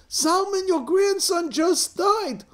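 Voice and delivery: high, slow wail; hysterical news-breaking. Acted. Crying women telling father of grandson, high wail. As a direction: deliver the death as a drawn-out lament. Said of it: "two favorite women in the world crying".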